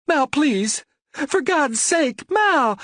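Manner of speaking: a cornered wife begging in panic; frightened woman. A narrator's reading.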